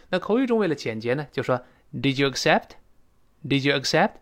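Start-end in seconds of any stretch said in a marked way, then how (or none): 1.9-4.1 "Did you accept" lifting at the end; rising question tune. Shown as a demonstration. Teacher modelling the short spoken question, rising. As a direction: raise the pitch on "accept". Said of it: "注意是声调啊".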